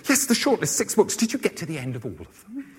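mock TV presenter. Selina Scott asking the chair of judges, mock presenter voice. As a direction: put on a presenter's high, probing question.

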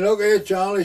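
loud, high call across the room. Retold or imitated. Farmer's wife calling to her sick husband, loud and high. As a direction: raise the voice to wake up the old man.

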